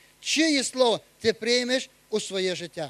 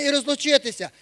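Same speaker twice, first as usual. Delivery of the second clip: ordinary talk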